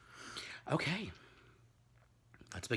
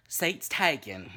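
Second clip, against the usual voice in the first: put-on slow, loud Southern child's line; slow mock Southern accent; Southern movie quote; 6 semitones higher, 9 dB louder, much slower than usual. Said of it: "Southern accents".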